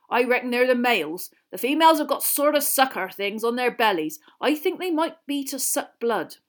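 loud gamekeeper voice, lifting in pitch; Hagrid, enthusiastic. A narrator's reading. Hagrid describing males and females, heartily keen. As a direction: explain with hearty interest.

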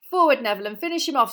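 rousing call to attack; teacher's command. A narrator's reading. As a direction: urge the pupil into action. Teacher orders pupil forward.

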